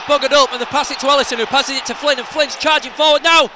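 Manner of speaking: fast, loud, high play-by-play; excited commentary. Real, not acted. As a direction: call the attack as it builds. Live match commentary.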